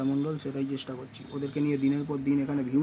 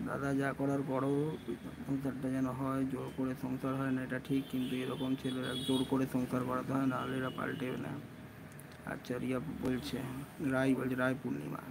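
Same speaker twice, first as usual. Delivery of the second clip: softer, low-key reading of comments; speaking quietly; 3 semitones lower, 8 dB quieter than usual. Real, not acted. Streamer reading comments to viewers while keeping his voice down. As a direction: keep it down, someone is inside. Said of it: "একটু আস্তে আস্তে বলছি".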